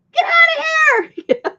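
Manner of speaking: high, slow screamed order; retold scream. Neighbor yelling at a strange dog in her yard, high shout. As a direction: yell it like you're terrified. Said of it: "And she goes".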